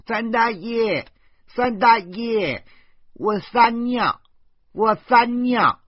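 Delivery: put-on high, slow little boy's call from the next room; drawn-out child's yell for his uncle. Little nephew calling his uncle from the living room, high and drawn out. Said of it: "豆豆在客厅里大叫起来".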